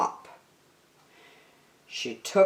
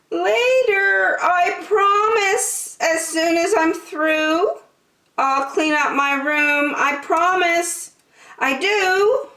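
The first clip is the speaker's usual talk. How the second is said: put-on pleading little girl; 14 semitones higher than usual. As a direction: beg for a bit more time. Jillian pleads with her mother.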